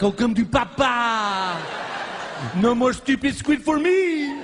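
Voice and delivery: lightly voiced French-accented shark, laughing; a hungry shark purring in a French accent; a light French accent, pleased with its prey. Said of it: "why the shark had a French accent".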